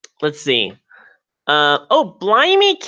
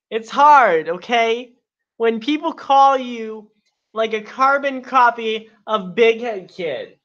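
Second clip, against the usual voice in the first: mock wounded; 5 semitones higher than usual. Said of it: "you're just so funny".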